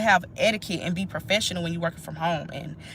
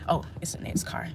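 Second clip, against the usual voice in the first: whisper; 3 semitones lower, 8 dB quieter than usual. Real, not acted. Pauses for passing car.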